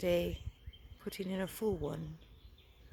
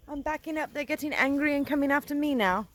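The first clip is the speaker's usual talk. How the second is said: alarmed and hurried; 7 semitones higher, 7 dB louder, faster than usual. Real, not acted. Fleeing angry bees.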